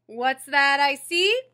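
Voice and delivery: big, slow, teasing call; playful bus driver. Acted. Driver greets the boy boarding, big slow tease.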